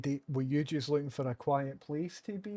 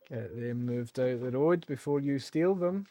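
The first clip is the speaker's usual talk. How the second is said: normal talking voice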